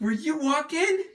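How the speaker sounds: high, slow cooing question. Real, not acted. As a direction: coo the question gently. Mother repeats her question to the baby.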